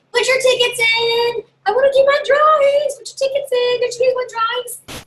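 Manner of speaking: high, loud party-host call; excited hostess drumming up the crowd; rallying the guests. Acted. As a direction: holler for everyone's attention in a high voice.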